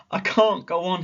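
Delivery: put-on a tired boy giving up; high and worn out; defeated sigh. A narrator's reading. Exhausted Sammy on the mountain, defeated. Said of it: "He sighed and dropped his head".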